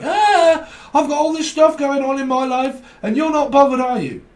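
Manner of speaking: high loud frantic mock complaint; headless chicken rant. Mocking a frantic housemate, high loud hammed-up panic. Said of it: "like a goddamn headless chicken".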